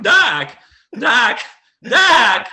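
high, loud squawking Doc; like stepping on a duck; Michael J. Fox squawk. Pretend. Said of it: "my impression of Michael J. Fox is only one word".

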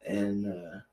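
soft, slow seductive sign-off; sultry. Pretend. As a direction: purr it like a porn star. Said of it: "That's why you're a porn star".

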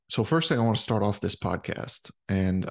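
ordinary talk, nothing marked

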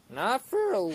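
real accidental high-pitched crack. Real, not acted. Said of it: "whoa, voice crack".

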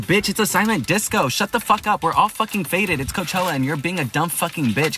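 high-pitched exasperated rant; indignant outburst. Retold or imitated. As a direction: retell telling off security in a high, worked-up voice. Acted outburst at security guards.

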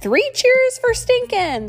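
high, loud and slow cheer. Acted. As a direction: cheer for the hero. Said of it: "the other pirates cried".

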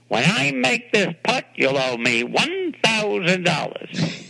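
high, slow taunting voice; playful golf-course taunt, drawn out. Retold or imitated. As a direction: tease him slowly from up high. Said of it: "and the voice, I did it on the show, goes".

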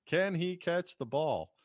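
high, slow mock commentator suspense; imitated sportscaster call; Niederman. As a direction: draw out the question like a play-by-play man. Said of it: "Niederman goes on to say".

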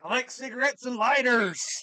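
high, hammed-up silly voice; mock attacker. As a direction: play the creep as a goofy caricature. Mocking the attacker's odd talk.

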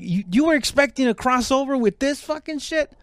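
a thug lecturing his victims, mock outrage up high; scornful robber. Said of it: "'Cause he was actually like".

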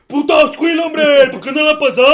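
high, loud shout at a teammate; mock yell. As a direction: yell for the ball on the pitch. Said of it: "solo andaban ahí". (gritándole a Ashkil que pase la pelota)

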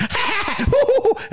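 very high mock guffaw; high hammed-up laugh; imitated exec laugh. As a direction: do the network bursting out laughing. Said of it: "the network's like".

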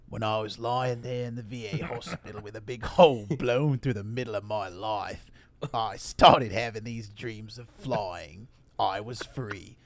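opening line in a put-on Australian accent; bad Australian accent. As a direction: mimic an actor whose Australian slips through. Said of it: "That's what it sounded like".

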